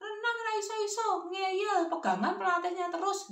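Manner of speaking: high-pitched granny complaint on the phone; acted high, nagging grandma; complaining grandmother. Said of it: "dia langsung berkata".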